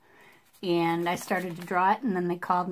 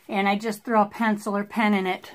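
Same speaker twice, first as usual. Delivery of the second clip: ordinary delivery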